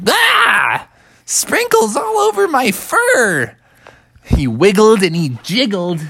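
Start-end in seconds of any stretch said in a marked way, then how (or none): 0.1-3.5 very high squealing bear cub; dismayed little bear. Acted. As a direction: shriek about sprinkles in his fur. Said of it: "And Bubby was like".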